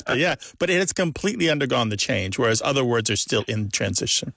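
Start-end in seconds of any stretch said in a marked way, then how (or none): none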